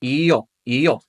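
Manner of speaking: i-yo, i-yo as ee-yoh, shorter each time; "i-yo" tightening toward one syllable; vowels practised together. Shown as a demonstration. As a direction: glide from ee into oh on "i-yo". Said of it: "if we practice them together".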